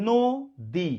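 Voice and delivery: নদী slowly split as "no - di"; syllable by syllable. Shown as a demonstration. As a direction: break নদী into two held syllables with a gap. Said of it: "ধীরে ধীরে উচ্চারণ করেন". (নদী দুই ভাগে, ধীরে ধীরে)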